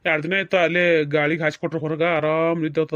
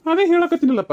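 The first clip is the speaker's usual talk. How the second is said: higher and louder, pleading to be understood; exasperated protest; 11 semitones higher, 5 dB louder than usual. Acted. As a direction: burst out in protest. Husband protests to crying wife. (ಅಸಹನೆಯ ಜೋರು ದನಿ)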